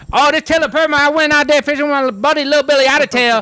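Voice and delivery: squeaky backwoods character voice; high-pitched mock Southern hillbilly. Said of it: "He had that voice".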